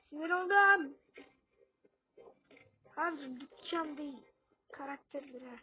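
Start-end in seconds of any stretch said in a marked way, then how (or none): 0.1-1.0 frustrated. Real, not acted.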